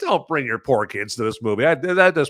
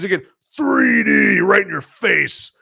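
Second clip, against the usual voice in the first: higher, slower, hammed-up shout; playful shout; 8 semitones higher, slower than usual. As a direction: belt it like a cheesy 3D trailer. Hamming up the 3D beer shot.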